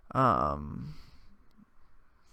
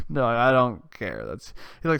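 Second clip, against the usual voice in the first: ordinary delivery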